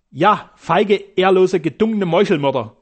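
loud, unflinching retort; fearless. Acted. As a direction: hurl it back fearlessly. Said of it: "antwortete der Deutsche furchtlos".

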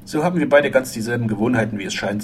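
plain reading voice

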